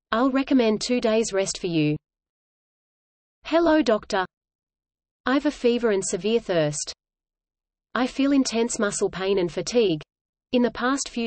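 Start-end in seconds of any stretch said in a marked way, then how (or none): none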